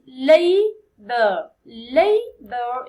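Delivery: slow, loud model word for the class; loud, slow teacher modelling; slow model reading. Shown as a demonstration. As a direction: read it out slowly and clearly for students. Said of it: "it's two syllables".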